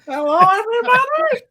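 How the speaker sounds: very high, loud, held-out singsong hello; sing-song greeting. Pretend. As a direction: call it out in a high cartoonish chime. Host mocks greeting in sing-song.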